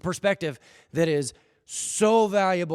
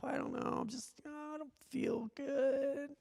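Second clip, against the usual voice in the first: high, much softer feeble moaning; mocking complainers in a high, faint whine; mock whiner; 10 semitones higher, 14 dB quieter, slower than usual. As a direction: put on a limp, self-pitying whimper. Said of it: "people are like".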